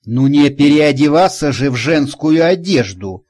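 usual speaking voice

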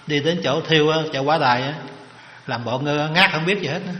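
ordinary talk

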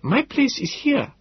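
put-on high old professor's voice, slow and firm; Gödel, resolute. A narrator's reading. Gödel insisting he stays, slow, high and firm. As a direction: settle it slowly, old man's voice.

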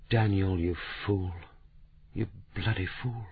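soft, hushed and tearful; tearful reproach. Acted. Kate, in tears, quietly scolding Daniel: soft tearful reproach. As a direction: choke it back, quietly scolding him. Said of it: "her face was wet with tears".

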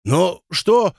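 high, baffled questions; puzzled, rising in pitch; bewildered. A narrator's reading. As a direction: ask it completely at a loss. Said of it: "вытаращился на него ничего не понимавший обыватель".

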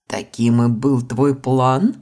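slow, lightly voiced snake; unhurried talking python. Python answers the heroine. (неспешный голос питона)

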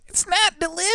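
very high, piercing cry; high-pitched irritating voice. Retold or imitated. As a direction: squeal it like the ad guy. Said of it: "he has this really high-pitched, irritating voice".

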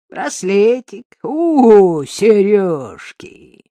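loud, drawn-out greedy relish; gloating. A narrator's reading. Mugger spotting a woman's earrings, gloating. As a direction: savour each find slowly and loudly.

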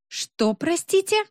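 slow, very high rising question; incredulous asking back. A narrator's reading. Woman questioning the smoke machine excuse, slow high incredulity. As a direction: ask back in drawn-out disbelief. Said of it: "переспросила Роза Жановна".

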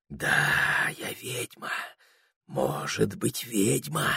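soft, half-whispered reply; hushed admission. A narrator's reading. The witch answering Прохор, hushed admission. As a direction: admit it under your breath.

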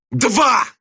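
high, exultant shout; Slushkin's triumphant bark. A narrator's reading. Teacher pounces with a failing grade, triumphant bark. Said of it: "торжествующе рявкнул Слушкин".

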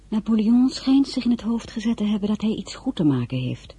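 normal talking voice

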